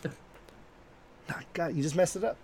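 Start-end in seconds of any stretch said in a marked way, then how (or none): 0.0-0.1 hissed aside, whispered and much softer. Real, not acted. Cutting off a co-host mid-reading with a whispered aside. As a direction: whisper it sharply so the mic barely catches it.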